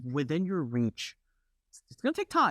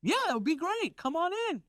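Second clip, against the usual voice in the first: much higher, eager caricatured client; high mock client voice. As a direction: chirp the friendly invite. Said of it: "They're like".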